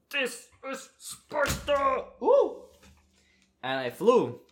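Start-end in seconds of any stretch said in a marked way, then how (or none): 0.1-2.0 high, slow movie battle cry; Sparta battle cry. Retold or imitated. Skydiving instructor jumping out, hamming up a slow Sparta battle cry. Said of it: "he still managed to pretty much go". 2.2-2.6 very high, loud whoop of thrill; falling whoop. Real, not acted. Jumping from the plane, a high thrilled whoop.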